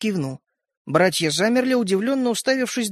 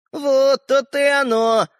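smirking; 7 semitones higher, 4 dB louder, slower than usual. A narrator's reading. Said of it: "усмехнулся человечек".

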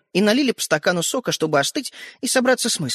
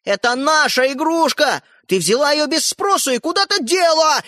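slow indignation climbing higher; building to shout; 7 semitones higher, 3 dB louder than usual. A narrator's reading. Brother accuses sister over toy, shouting. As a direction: build into a high, angry yell. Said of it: "перешёл на крик Родька".

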